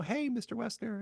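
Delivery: high-pitched mimicked student hello; friend's greeting. Student greeting the chorus teacher, mimicked eager hello.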